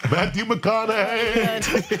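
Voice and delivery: taunting neighbour calling down slowly; heckling call. Retold or imitated. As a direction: call it out slow and mocking from upstairs. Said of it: "Every time he went back there".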